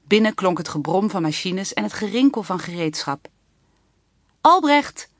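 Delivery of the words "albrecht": loud, high call of a name. A narrator's reading. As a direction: call into the noisy workshop. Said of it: "riep Lieve".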